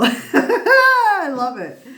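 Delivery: high, loud, slow laughing gush; laughing delight. Real, not acted. Laughing at the card's joke.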